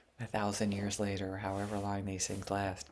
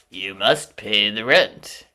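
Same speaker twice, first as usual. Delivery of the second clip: slow, loud, sneering villain; sneering panto villain; 16 dB louder, slower than usual. Acted. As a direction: twirl the moustache and demand it. Melodrama villain demanding rent.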